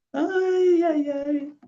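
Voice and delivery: high, drawn-out lamenting ay-ay-ay; dismayed wail. Acted. Priest laments curses from lips meant for praise, dismayed wail. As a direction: wail in theatrical dismay, falling slightly.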